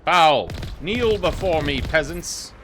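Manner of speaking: loud, high, slow tyrant command; booming demon lord. Pretend. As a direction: roar down at the peasants. Taunting enemies as overlord.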